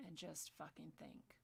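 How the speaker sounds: whispered plea, no voice. Real, not acted. Ranting to viewers, whispering that they should just think. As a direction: drop to a bare whisper to make them think. Pleading for calm thought.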